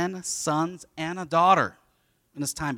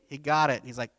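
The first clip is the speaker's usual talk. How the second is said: usual speaking voice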